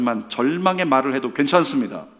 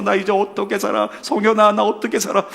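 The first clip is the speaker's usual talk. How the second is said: mock wail, higher and louder; imitated tearful despair; 6 semitones higher, 4 dB louder than usual. Dumped teenager to his friend, imitated tearful despair. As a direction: imitate a heartbroken teenager wailing. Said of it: "그러면서 막 울고 있었던".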